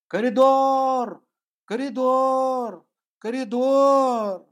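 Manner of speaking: drawn-out shout, high and loud, slow; yelling. Retold or imitated. Man yelling his mantra in an office corridor, drawn-out shout. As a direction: bellow the word down the hall, slowly.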